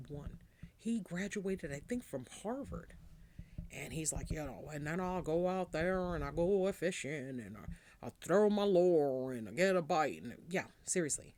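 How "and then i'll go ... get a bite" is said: lightly done Southern drawl, getting louder; a mild Foghorn Leghorn twang. Retold or imitated. Mocking a Louisiana congressman's country drawl. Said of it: "He sounds like Foghorn Leghorn".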